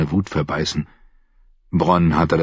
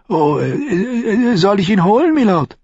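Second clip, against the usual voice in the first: flustered squire; 13 semitones higher, 3 dB louder than usual. Acted. Pod just woken, asking his lord, high flustered stammer. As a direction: stammer sleepily in a high boy's voice.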